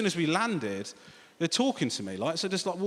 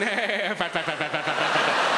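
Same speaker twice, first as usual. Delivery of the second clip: words chattered out as a laugh; mock cackle made of one repeated word; imitated laughter; 4 dB louder than usual. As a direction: stutter it rapidly like helpless laughter. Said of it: "she's going".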